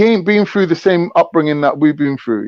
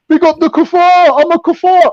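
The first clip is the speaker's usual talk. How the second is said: much higher mocking imitation of a rival's boast; 12 semitones higher, 3 dB louder than usual. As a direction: ham up the rival bragging he's a kuffar. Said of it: "he's like".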